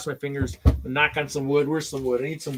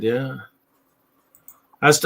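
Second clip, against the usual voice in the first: ordinary talk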